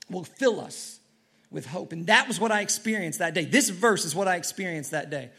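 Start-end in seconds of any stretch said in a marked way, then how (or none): none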